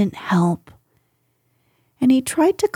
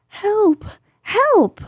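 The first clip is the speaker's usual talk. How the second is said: very high panicky cry for help; frightened cry; 11 semitones higher than usual. Acted. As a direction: squeal for help in terror. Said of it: "cried Piglet".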